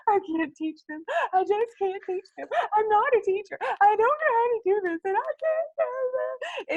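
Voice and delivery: high, loud, wailing sobbed pleas; imitating ugly crying. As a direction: wail it like a mom at the end of her rope. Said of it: "ugly crying".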